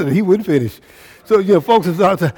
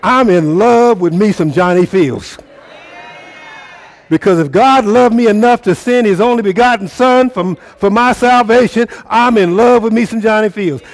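higher and louder, building the call; full-force preaching; 6 semitones higher, 5 dB louder than usual. Acted. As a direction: lift them up from the pulpit, voice soaring.

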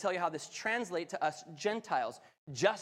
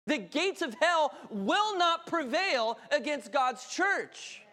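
higher, louder building preach; rising preaching; 8 semitones higher, 4 dB louder than usual. Real, not acted. Preacher rallying the church to unite, higher and louder.

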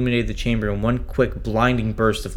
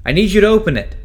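much higher, louder call for help; urgent plea; 10 semitones higher, 8 dB louder, faster than usual. Acted. Trapped astronaut calling Kev over the comm, urgent and loud. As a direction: demand the door be opened, fast. Locked in, calling control room.

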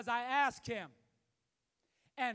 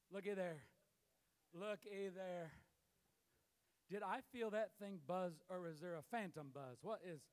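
distracted mutter; 5 semitones lower, 7 dB quieter than usual. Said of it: "And then we go".

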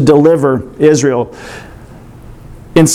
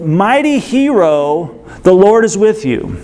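angel's greeting; 3 semitones higher than usual. Acted. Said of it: "he is greeted with".

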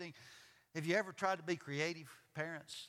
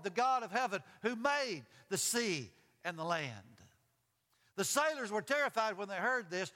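animated Bible story, higher on the dialogue; dramatic scripture reading; 5 semitones higher, 4 dB louder than usual. A narrator's reading. Preacher reading Jonah and the sailors to the congregation, dramatic. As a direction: read aloud with weight, stretching phrase endings.

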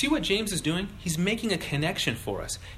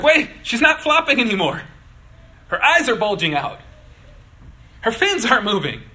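high, loud mock alarm; hammed-up panicked onlooker; alarmed fish; 6 semitones higher, 9 dB louder than usual. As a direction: cry out in comic dawning horror. Voicing fish realising friend is dying.

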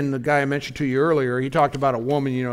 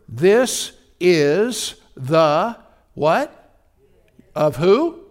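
drawn-out prompting; 2 semitones higher, 6 dB louder, much slower than usual. Shown as a demonstration. Preacher pausing for congregation to fill in.

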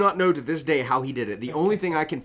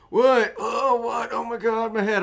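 higher, dazed mock groan of a hungover guy; groggy hungover friend; 9 semitones higher than usual. Mimicking the hungover friend woken on the couch, dazed and higher. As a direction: play the just-woken friend clutching his head. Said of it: "And he's like".

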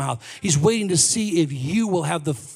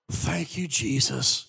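reluctant prayer; 6 semitones lower, 6 dB quieter, slower than usual. Retold or imitated. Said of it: "it's a reluctant prayer on my behalf".

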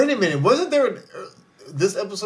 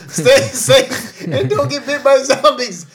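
laughing; 7 semitones higher, 6 dB louder, slower than usual. Real, not acted. Laughing sign-off to listeners.

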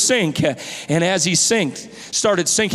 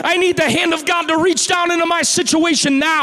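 full-force preaching; 7 semitones higher than usual. Acted. Pastor urging the church to need God's hand, shouting. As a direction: build the sermon to its peak.

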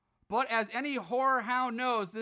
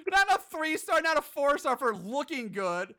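laughing incredulity; 6 semitones higher, 4 dB louder than usual. Real, not acted. Laughing at review logic.

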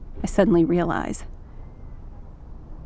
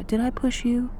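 soft gentle question; 5 semitones higher, 6 dB quieter than usual. Acted. Dad asking child softly.

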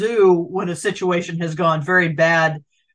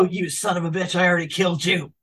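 low, gravelly-free tough cop read; dramatic reading; 4 semitones lower, 4 dB quieter, faster than usual. Retold or imitated. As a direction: read it like a grizzled detective. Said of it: "the dramatic reading with it".

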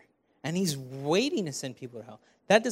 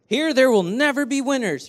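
higher, louder hammed-up announcer; mock game-show announcer; 7 semitones higher, 6 dB louder than usual. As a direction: read the scratch card like an announcer. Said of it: "And it says".

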